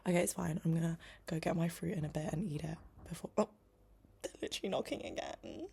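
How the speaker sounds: ordinary talk, nothing marked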